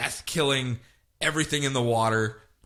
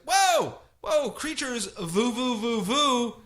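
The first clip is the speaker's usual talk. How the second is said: high, hurried hush of the animals; calm the sea creatures in a high bro voice; high, soothing whoa; 8 semitones higher than usual. Retold or imitated. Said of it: "And he's like".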